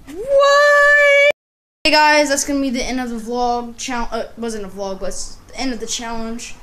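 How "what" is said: high startled yelp of surprise; shocked cry. Real, not acted. Shocked reaction at the door.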